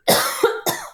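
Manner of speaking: a quick dry cough. Real, not acted. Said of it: "'cause I'm coughing".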